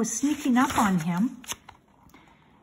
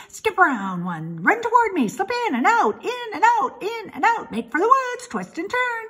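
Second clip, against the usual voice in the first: high, loud, frantic goose; urgent high-pitched goose cry; goose crying orders; 11 semitones higher, 4 dB louder than usual. A narrator's reading. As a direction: squawk out commands like a bossy goose. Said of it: "The goose took command and began to give orders".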